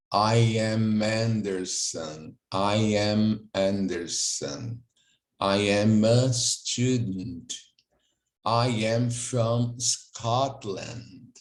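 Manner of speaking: very slow, each word modelled clearly; slow model reading. Shown as a demonstration. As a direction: read it slowly for students to repeat. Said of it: "voltando aqui". (leitura modelo bem devagar)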